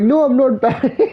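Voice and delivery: high mock-Indian protest breaking into laughter; mimicked Indian accent. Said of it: "And they're like".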